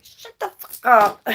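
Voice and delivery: hammed-up angry mind; mind snapping. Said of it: "your mind's in here like".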